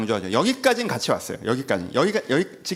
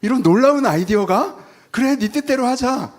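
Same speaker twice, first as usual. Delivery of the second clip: higher, louder, slow mock amazement; mock God persuaded; 7 semitones higher, 6 dB louder, slower than usual. Preacher voicing God, mock amazed and slow. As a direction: ham up God being won over by a pitch. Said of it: "하나님 여러분 얘기를 듣더니".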